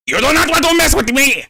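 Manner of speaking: high shrill cartoon voice; mock Cornholio threat. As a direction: bark it like a cartoon character. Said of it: "I am Cornholio!".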